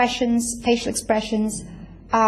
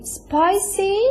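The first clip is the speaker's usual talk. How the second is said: "Spicy" rising much higher, drawn out as a prompt; 9 semitones higher than usual. Shown as a demonstration. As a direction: lift "Spicy" high and wait for the learner. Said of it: "you just say".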